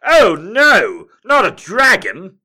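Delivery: high, loud mock panic; hammed-up scared prince. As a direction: overplay the hero's fright. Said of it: "Prince Charming goes".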